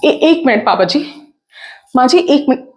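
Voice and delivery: loud, fast, stammering reassurance; flustered daughter-in-law. Acted. Daughter-in-law urging in-laws to ignore the crash call, flustered. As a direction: talk over them urgently, pitch jumping on each address.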